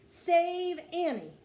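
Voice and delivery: high, loud, drawn-out call; shouted plea. A narrator's reading. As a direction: shout it slowly up to the sky. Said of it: "he shouted".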